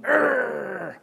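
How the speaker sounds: high, drawn-out "urgh" drone; childish blocking-out noise. Acted. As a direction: drown someone out like a child with fingers in ears. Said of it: "put their fingers in their ears and went".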